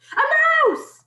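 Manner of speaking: high, loud cry of alarm; shouting. A narrator's reading. Cooks alerting each other to a mouse, shouting. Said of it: "they were shouting".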